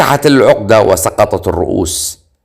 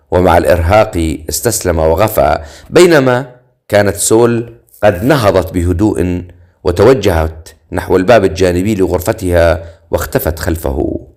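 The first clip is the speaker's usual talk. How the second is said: usual speaking voice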